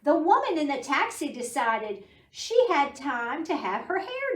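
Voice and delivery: bright storybook reading voice; teacher reading a rainy-day book to preschoolers, storybook voice; reading to children. Acted. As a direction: read it like story time for little kids.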